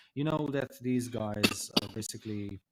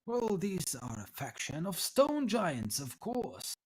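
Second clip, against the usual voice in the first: higher, swooping explanation; old tiefling scholar, knowing; 7 semitones higher than usual. Acted. Tiefling tells visitors about the giant faction, higher lightly voiced lecturing. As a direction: explain it as common knowledge, pitch lifted.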